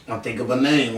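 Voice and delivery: normal talking voice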